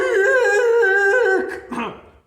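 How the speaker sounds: a sudden cough; coughing fit; real cough. Real, not acted. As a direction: cough after straining the voice.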